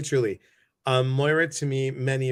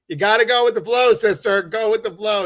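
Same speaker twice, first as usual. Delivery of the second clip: louder, quick, carefree urging; breezy exhortation; 9 semitones higher, 8 dB louder, faster than usual. Retold or imitated. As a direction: loosen her up, sister. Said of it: "I'm like".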